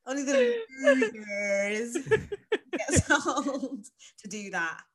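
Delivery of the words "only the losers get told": slow, laughing and breaking up; laughing at her own joke; words half-swallowed by laughter; laughing through it. Real, not acted.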